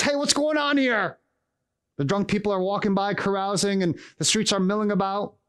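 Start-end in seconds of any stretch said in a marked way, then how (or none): 0.0-1.2 high-pitched calling out; mock soldier's yell. Roman soldier walking by the noisy stable, yelling. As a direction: yell it like a soldier breaking up a commotion. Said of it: "a Roman soldier walks by and yells".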